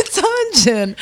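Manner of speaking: high-pitched reveal through amusement; giddy reveal. Retold or imitated. Retelling her answer to mom, giddy high reveal. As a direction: blurt it high, close to laughing.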